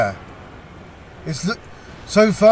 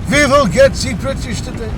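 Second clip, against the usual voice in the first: high, hammed-up French accent; a badly faked French accent for a charging soldier; mock French soldier in a bad French accent; terrible French accent; 7 semitones higher, 3 dB louder than usual. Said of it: "Oh, that was a terrible accent".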